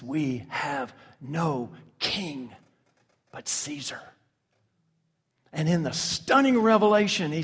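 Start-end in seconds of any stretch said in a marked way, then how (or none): 0.0-4.0 very slow, hushed and deliberate; grave quotation. Retold or imitated. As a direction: weigh each word softly, letting the last drop away. Quoting a stunning statement of apostasy.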